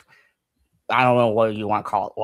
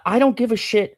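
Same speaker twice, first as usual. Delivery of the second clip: ordinary delivery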